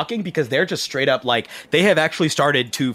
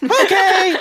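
high voice, rallying loudly at the end; anxious eager guide; 12 semitones higher, 3 dB louder than usual. Acted. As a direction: pump yourself up through nerves. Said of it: "he's like".

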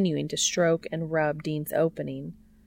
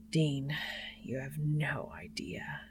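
unvoiced, much softer whisper; breathless; 9 dB quieter than usual. A narrator's reading. Cass confessing to Dean, breathless whisper. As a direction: breathe it out, barely voiced. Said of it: "Cass sounds breathless now".

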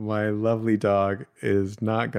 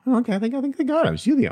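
excited; faster than usual. Real, not acted. App confirms, excited high voice.

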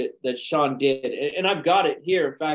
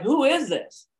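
frustrated; 11 semitones higher, slower than usual. Retold or imitated. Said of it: "She's like".

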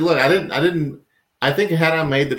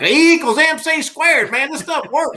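put-on Einstein with a Southern accent. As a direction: play Einstein as a country bumpkin with a Southern accent. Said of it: "if Albert Einstein had said, like".

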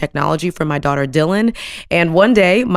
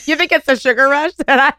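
laughing; 7 semitones higher, 4 dB louder than usual. Real, not acted. Guest jokes about donut.

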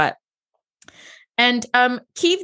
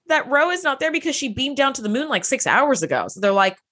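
ordinary delivery

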